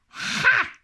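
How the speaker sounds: high loud evil cackle. Acted. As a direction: cackle in triumph. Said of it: "cackled the girl".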